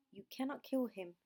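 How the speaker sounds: ordinary delivery, nothing marked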